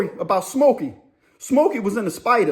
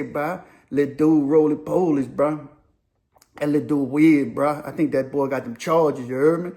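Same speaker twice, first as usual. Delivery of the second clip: lower, tough inmate drawl; Slim Monies; 5 semitones lower than usual. Retold or imitated. Slim Monies tells the yard the dude is weird, low and sneering. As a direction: sneer it low like a hard convict. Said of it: "Slim Monies used to be telling".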